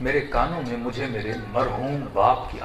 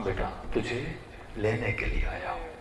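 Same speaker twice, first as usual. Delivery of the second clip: hushed father; 4 semitones lower, 10 dB quieter than usual. Acted. Dead father speaks to son.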